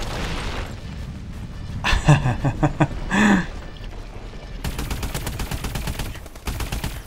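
long unvoiced laughing; breathy laugh. Real, not acted. Laugh after the act.

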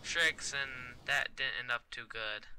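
normal talking voice

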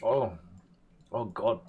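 ordinary delivery

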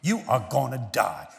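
imitating pastor. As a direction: call the children like a stern pastor. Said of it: "He said".

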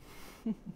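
short quiet laugh; brief, gentle giggle; soft chuckle. Real, not acted. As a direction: give a small knowing chuckle. Chuckles after teasing the listener.

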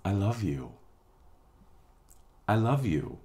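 spoken softly to the shadow self with compassion; tender reassurance. Acted. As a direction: gently reassure your own fear. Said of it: "breathe a sigh of relief and say".

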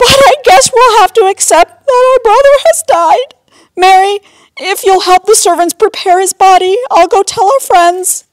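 very high, loud weepy wail; hammed-up tearful squeal throughout; sobbing. A narrator's reading. Sister planning the burial, wailing tearfully. Martha sobbing over brother's death.

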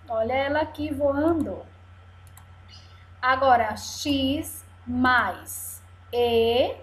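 ordinary delivery

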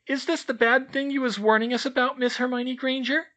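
very high, loud squeak; a tiny house-elf squeaking a greeting; squeaky elf. A narrator's reading. Elf greeting the children in a high squeak.

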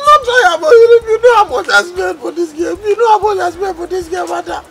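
high-pitched, loud wail of protest; panicky, high outcry; distressed protest. Acted. Acted protest over money spent.

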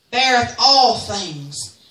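measured, very slow recitation; slow scripture reading. Real, not acted. Preacher reading First Corinthians to the church, slow and solemn. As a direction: read each line of the verse with weight.

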